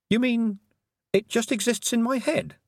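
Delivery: put-on high, curious girl voice; puzzled young girl. Tiffany voice.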